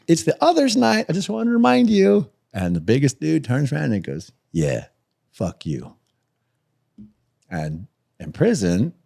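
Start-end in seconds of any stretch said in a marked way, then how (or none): 0.0-2.3 much higher, louder and overly polite; squeaky fake-sweet politeness to tough guys; Mister Nice Guy. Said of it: "Mister Nice Guy". 4.5-5.9 lower, dismissive growl of a big inmate; tough guy brush-off. Retold or imitated. Biggest dude brushing him off.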